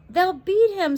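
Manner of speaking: high, loud anguished scream; a boy screaming in fear for his friend. Acted. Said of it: "screamed Tom".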